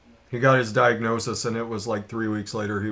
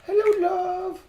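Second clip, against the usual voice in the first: much higher sing-song voice; pet talk. Real, not acted. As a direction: coo to the cat, high. Calling to her cat.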